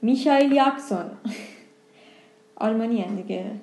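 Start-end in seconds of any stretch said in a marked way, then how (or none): 0.0-1.1 میشایل یاکسون with sh and a y for j; German-style name. Retold or imitated. Joking about Germans naming a pop star, German-style [name].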